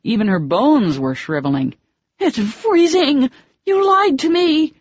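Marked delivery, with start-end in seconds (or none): 2.2-4.7 very high, loud, slow outraged cry; furious shriek. Acted. Cold water, furious at him.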